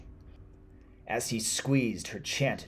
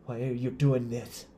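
loud, higher-pitched desperate cry; anguished cry; 4 semitones higher, 5 dB louder than usual. Acted. Tortured man questioning the witch, crying out. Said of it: "he cried".

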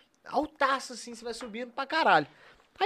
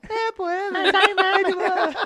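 higher and drawn out slowly, laughing; slow, laughing squeaky impression; squeaky little voice; 10 semitones higher, 5 dB louder, much slower than usual. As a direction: stretch out the squeaky imitation. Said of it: "Ele tem essa vozinha?".

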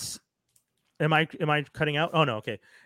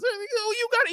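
high mock reassurance. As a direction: voice jumps much higher, coaxing. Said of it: "he's just like".

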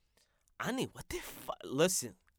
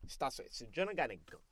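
normal talking voice

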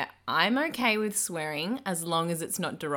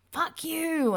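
imitating an angry driver, higher pitch; a hammed-up driver swearing from his car; mock irate yell; 11 semitones higher than usual. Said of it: "he's driven past going".

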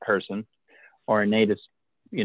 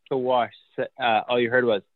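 ordinary delivery

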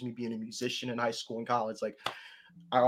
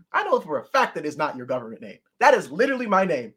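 louder, higher, mock-outraged protest; indignant; 3 semitones higher, 7 dB louder than usual. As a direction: scold the name thief. Indignant quote at name squatters.

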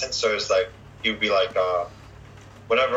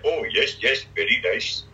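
put-on Apu-style Indian accent; 2 semitones higher than usual. Customer asks an Indian food-court server about curries, Indian accent. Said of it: "Apu's voice".

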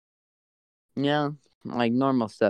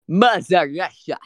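loud, higher Russian accent; mock Russian accent; 6 semitones higher, 5 dB louder than usual. Shown as a demonstration. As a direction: boom it in a hammed-up Russian accent. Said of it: "My keywords are".

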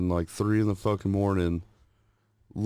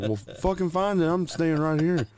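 high mock-panicked insistence; scared refusal; 9 semitones higher than usual. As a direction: stay put and bark at her to find it. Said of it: "And I was like".

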